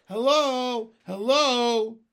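long, high, loud hail; calling out. Acted. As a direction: holler down to a distant ship. Calling down to the ship.

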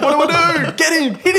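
loud, very high frantic yelling; mock panic. Son egging dad on against key thief, comic panic. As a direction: squeal it out in comic alarm. Acts out his panic at theft.

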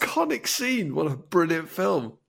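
high, gushing praise; thrilled, voice soaring high; excited. Real, not acted. Gushing about favourite film.

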